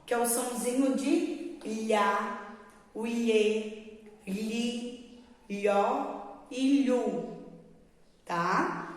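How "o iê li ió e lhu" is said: slow, careful "iê, li, ió, lhu" with the tongue pulled back; teacher modelling the lh sound for children; lh sounds drawn out. Shown as a demonstration. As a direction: sound out each "lhu" syllable slowly for the class. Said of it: "é isso que vocês têm que ouvir".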